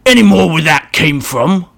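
a brutish orc taking his time. Acted. Orc voiced.

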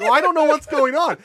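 high, loud flustered cry; mock-panicked wail; retold panic. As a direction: act out being lost in a panic. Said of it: "I'm panicking".

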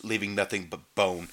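normal talking voice

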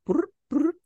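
high playful trilled brr; ferret hopping noise. Retold or imitated.

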